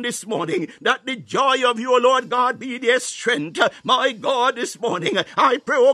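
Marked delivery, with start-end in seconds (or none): none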